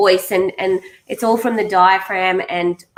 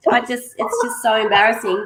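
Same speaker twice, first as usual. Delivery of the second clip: usual speaking voice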